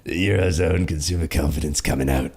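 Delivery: low London growl; Jason Statham. Retold or imitated. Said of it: "It really did sound like Jason Statham".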